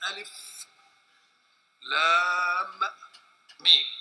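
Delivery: drawn-out لام, each of ألف لام ميم said separately; letter names recited. Shown as a demonstration. Teacher showing how the Quran's opening letters are read, letter names recited.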